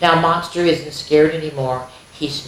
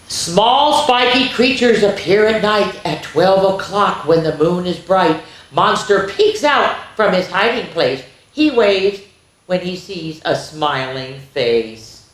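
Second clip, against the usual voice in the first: lively, higher storytime voice; reading to children; 4 semitones higher, 4 dB louder than usual. Acted. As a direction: read it out brightly for little ones. Reading picture book to children.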